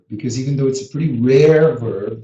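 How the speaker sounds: ordinary talk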